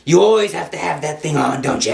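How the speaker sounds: a lightly voiced sneering devil; a hint of a mocking devil's voice; ugly devil voice. A narrator's reading. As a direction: taunt him like a mildly ugly demon. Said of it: "the devil in an ugly voice say".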